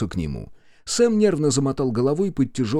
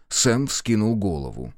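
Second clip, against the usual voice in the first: usual speaking voice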